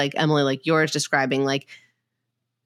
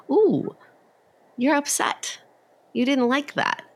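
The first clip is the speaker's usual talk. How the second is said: higher, gentle mock-soothing tone to a baby; lightly acted parent soothing a fussing baby; soothing a child; 9 semitones higher than usual. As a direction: coo an empathetic word to an upset child.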